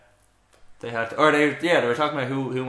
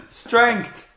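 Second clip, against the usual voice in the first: loud, high mock pundit; pundit impression; 7 semitones higher, 8 dB louder, much slower than usual. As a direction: ham up the TV pundit. Imitating pundit buzzwords.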